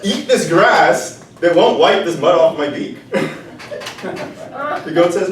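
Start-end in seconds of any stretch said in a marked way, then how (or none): 0.0-3.0 loud, higher, laughing command; bossy rooster order. Acted. The rooster ordering the goat, loud and laughing. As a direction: bark it out through laughter.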